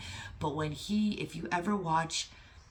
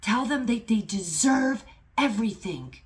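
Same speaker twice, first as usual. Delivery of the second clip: loud, high, urgent exhortation as God; impassioned shout, insisting on every word; screaming command; 5 semitones higher, 7 dB louder than usual. Retold or imitated. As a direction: cry it out like a divine order. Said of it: "God is, like, screaming".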